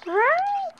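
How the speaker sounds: very high, squeaky question; pet cooing. Real, not acted. Cooing question to baby girl.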